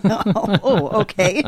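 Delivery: laughing through an amused okay; chuckling okay. Real, not acted. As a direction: let the laugh spill into the words. Laughing at guest's joke.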